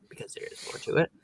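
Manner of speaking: fast breathy whisper. Retold or imitated. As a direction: mutter it under your breath. Whispered reply to quote.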